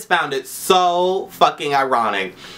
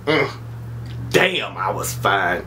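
slow, low, satisfied "Damn, I was fine"; self-admiring drawl; 8 semitones lower, 4 dB quieter, slower than usual. Acted. Vlogger imagining being old and proud, slow low smug drawl. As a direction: savour it like an old diva remembering.

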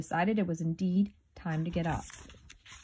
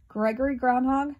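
ordinary delivery

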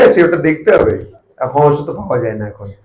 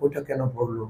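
hushed wondering; 5 semitones lower, 16 dB quieter than usual. Retold or imitated. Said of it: "এই লোকটি ভাবলো".

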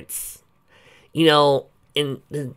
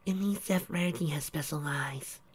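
whisper; 6 semitones higher, 9 dB quieter than usual. Acted. Unvoiced whisper aside.

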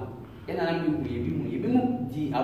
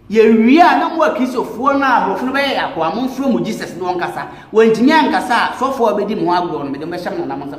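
much louder and higher, defiant shout; shouted challenge; 3 semitones higher, 8 dB louder than usual. Real, not acted. Priest daring the Christians to call on Jesus, defiant shout.

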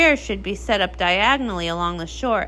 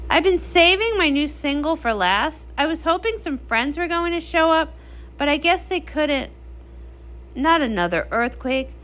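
ordinary talk, nothing marked

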